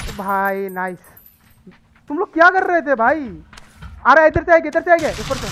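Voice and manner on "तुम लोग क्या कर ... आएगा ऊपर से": loud, higher scolding yell; angry shout. Real, not acted. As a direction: bawl out the teammates who never came. Scolding teammates who didn't come.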